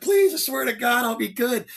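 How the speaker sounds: high, hammed-up begging; pleading recruit. Recruit begging the instructor for another chance, high hammed-up pleading. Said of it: "like a scene out of Blues Brothers".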